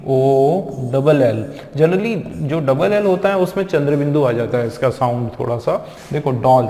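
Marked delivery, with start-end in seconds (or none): 6.3-6.7 "डॉल" with the open aw vowel; chandra bindu vowel. Shown as a demonstration. Teacher modelling the correct word, "डॉल" with open o. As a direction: say "डॉल" as "dawl", rounded and open. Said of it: "चंद्र बिंदु वाला".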